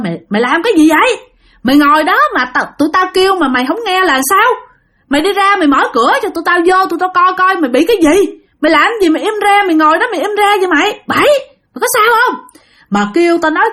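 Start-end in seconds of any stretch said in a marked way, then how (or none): 0.0-11.0 high, fast scolding yell; calling out sternly. A narrator's reading. Sáu yells at Bảy through the door, high urgent scolding. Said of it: "ông Sáu lại la lên". 11.1-12.4 high-pitched urgent call; worried yell. A narrator's reading. Sáu calls to silent Bảy, high worried yell. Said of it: "ông Sáu lại la lên".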